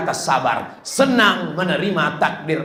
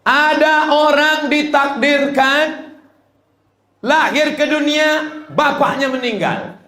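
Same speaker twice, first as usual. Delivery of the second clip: raised storytelling preach; 9 semitones higher than usual. Acted. Preacher telling the congregation a tragic tale, raised and dramatic. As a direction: lift the voice to tell a sad tale.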